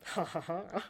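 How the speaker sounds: soft, deliberate smug laugh; smarmy. Retold or imitated. Y'shtola looking at him over tea, smarmy. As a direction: laugh smugly after a sip. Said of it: "very smarmy".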